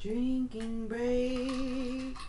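very slow, stretched-out words; drawn-out echo. Pretend. Partner echoing the drinking-break announcement, long drawn-out echo. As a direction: draw each word right out.

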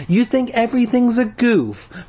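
high-pitched exasperated accusation; accusing. A narrator's reading. Tasha scolding the boy, accusing. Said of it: "she accused".

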